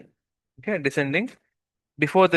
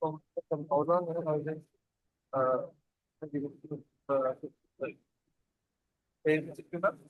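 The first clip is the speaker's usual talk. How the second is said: barely audible; 8 dB quieter than usual. Real, not acted. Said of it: "It's not clear".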